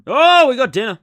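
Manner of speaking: high, loud happy burst; light up at the surprise; excited exclaim. Real, not acted. Boyfriend reacting to his girlfriend's dinner photo, loud delighted exclaim.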